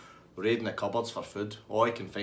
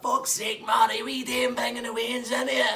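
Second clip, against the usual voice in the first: high, loud outraged yell; angry shout; 13 semitones higher, 6 dB louder, slower than usual. Retold or imitated. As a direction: shout him down in disbelief. Gary shouting at barman.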